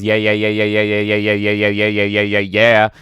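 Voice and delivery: quick monotone string of yeahs; rapid-fire repetition. Retold or imitated. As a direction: rattle them off without a break. Reading a chat message of yeahs.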